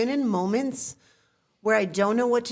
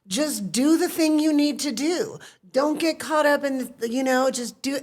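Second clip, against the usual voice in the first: a hint of dad, lightly voiced and higher; dad's advice; 6 semitones higher, 3 dB louder than usual. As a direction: lightly echo her father's no-fuss advice. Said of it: "That's so my dad".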